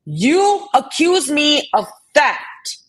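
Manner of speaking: angry rant at an accuser in chat; furious confrontation. Real, not acted. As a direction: tear into her point by point. Confronting Southern Girl over theft claim.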